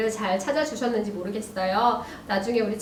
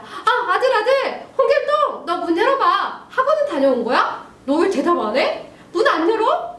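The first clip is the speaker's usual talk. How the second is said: high, raised nagging voice; acted angry mom; 8 semitones higher, 3 dB louder than usual. Mother at son's locked door, acted angry demands. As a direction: scold through a locked door, hammed-up. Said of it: "하면서 잔뜩 화가 난 상황입니다".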